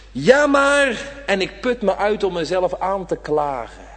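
normal talking voice

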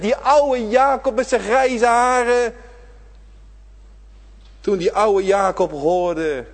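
loud, high, slow preacher's climb; full-force preaching. Acted. Preacher urging the congregation not to forget, at full force. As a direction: drive it home from the pulpit, stretching each word.

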